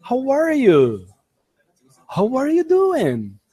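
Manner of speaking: "How are you" pitched high, then falling at the end; sing-song high "How are you doing", falling on the last word; exaggerated intonation. Shown as a demonstration. Teacher modelling "How are you" for learners, with exaggerated intonation. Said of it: "colocando entonação".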